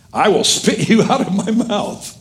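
high-pitched preacher's warning; preached rebuke. Acted. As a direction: pronounce judgement from the pulpit, pitched high. Preacher voices Jesus rebuking church.

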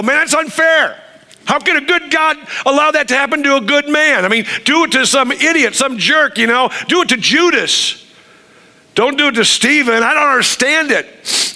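high, aggrieved whining protest; an upset believer asking why; indignant complainer. Retold or imitated.

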